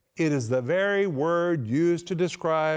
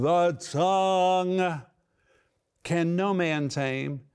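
raised voice; 2 semitones higher, slower than usual. Retold or imitated. Preacher voicing James declaring the tongue untameable, raised. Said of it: "It's like he's raising his voice".

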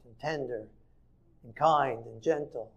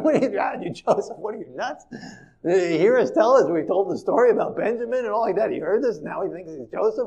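higher, scoffing, laughing disbelief; doubting brothers; 8 semitones higher, 6 dB louder than usual. Retold or imitated. Brothers scoff at the man claiming to be Joseph, higher and mocking.

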